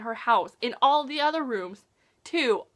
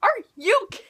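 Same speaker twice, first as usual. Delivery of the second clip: higher, louder, breaking off mid-word in shock; half-finished gasp of disbelief; stunned disbelief; 10 semitones higher, 4 dB louder than usual. Retold or imitated. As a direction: sputter it out, too stunned to finish. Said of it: "And I'm just like".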